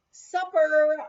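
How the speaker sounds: loud call of supper across the house; hollered supper call. A narrator's reading. Cook calling the guests to supper, loud call.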